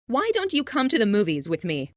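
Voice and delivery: ordinary delivery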